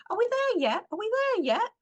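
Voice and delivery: put-on high, nagging child voice, repeated; whining kids. Director mimics whining children on a long trip, high and nagging. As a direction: whine it like bored kids in the back seat. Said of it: "in the back seat whining".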